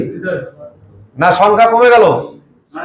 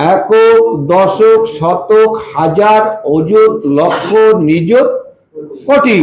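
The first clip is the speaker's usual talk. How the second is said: slow counting; much slower than usual. Shown as a demonstration. Teacher counts place values aloud.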